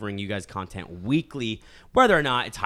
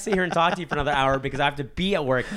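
higher, louder, fast mock fury; half-joking angry rant; 8 semitones higher, 7 dB louder than usual. Real, not acted. Griping to cohost about work, loud fast mock anger. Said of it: "I'm angry".

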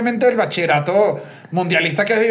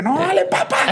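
high-pitched mock urging; high, excited-kid imitation; imitated cheering on; 11 semitones higher than usual. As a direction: egg them on in a higher voice. Retelling kids cheering at school.

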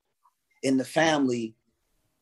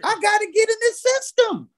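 much higher voice of mock disbelief; incredulous squeal. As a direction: play it wide-eyed and squeaky. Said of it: "I'm like".

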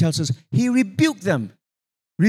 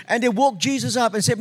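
normal talking voice

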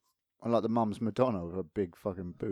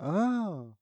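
put-out oh; 8 semitones higher, 3 dB quieter than usual. Retold or imitated. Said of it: "He's like".

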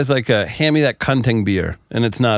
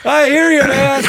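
mock buddy voice; 5 dB louder, slower than usual.

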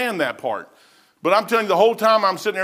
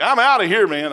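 eager exclamation; 5 semitones higher, 6 dB louder than usual. Retold or imitated. His eager response to God.

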